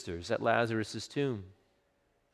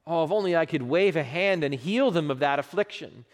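rising passionate longing; 7 semitones higher, 5 dB louder than usual. Pretend. Imagined longing to heal loved ones.

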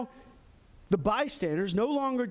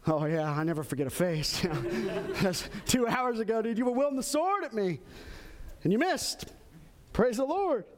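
comic retold recognition; 2 semitones higher than usual. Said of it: "he sees him, he's like".

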